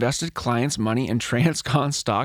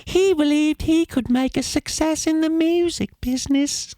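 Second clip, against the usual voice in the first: Dobby voice; 14 semitones higher than usual. Pretend. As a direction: read the quote as an eager little elf. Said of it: "That was a really good Dobby".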